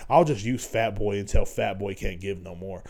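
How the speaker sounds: low, quiet, slow mock of a callous woman; mocking user woman. Mocking a woman who uses him, low and quiet. Imitating using women.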